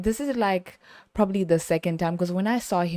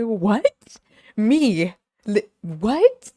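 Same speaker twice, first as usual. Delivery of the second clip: mock surprise; 4 semitones higher, 5 dB louder, much slower than usual. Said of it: "I was like".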